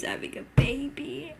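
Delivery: breathless disbelieving whisper. Real, not acted. Viewer repeats the twist to herself, whispering.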